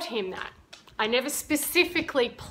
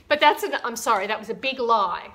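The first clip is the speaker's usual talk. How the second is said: ordinary talk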